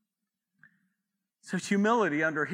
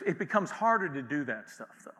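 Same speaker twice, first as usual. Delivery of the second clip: ordinary talk, nothing marked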